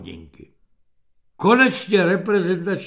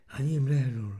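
ordinary delivery, nothing marked

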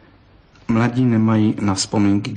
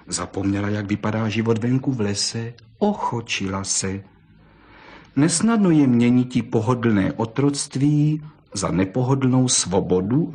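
plain reading voice

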